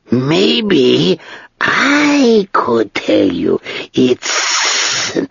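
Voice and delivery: slow, drawn-out, dry dragon voice; great dry voice of an ancient dragon. Acted. Dragon speaks to Ged.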